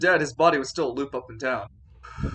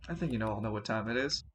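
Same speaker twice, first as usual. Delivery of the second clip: quiet grim line; 7 semitones lower, 11 dB quieter, faster than usual. Acted. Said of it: "Father Falcone stands there".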